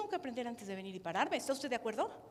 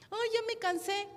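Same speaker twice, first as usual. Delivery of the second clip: whiny complaint; 9 semitones higher, 3 dB louder than usual. Retold or imitated. Imita a un servidor cansado.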